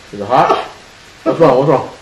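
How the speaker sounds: loud playful teasing. Real, not acted. Dad teasing son eating spicy.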